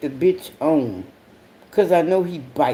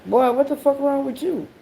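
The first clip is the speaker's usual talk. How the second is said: much higher, stunned question; high, baffled boy; incredulous scold; 9 semitones higher than usual. Retold or imitated. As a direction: scold a kid in disbelief. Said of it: "And I'm like".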